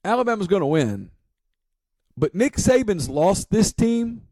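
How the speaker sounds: deliberate, pointed quote of what he said on air; retold radio take. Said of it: "I said".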